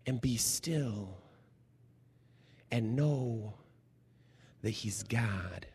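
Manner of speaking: slow, soft, almost whispered; preacher hushing the room, slow and soft; hushed and slow. Real, not acted. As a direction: let it sink in quietly. Preacher slows to a hush.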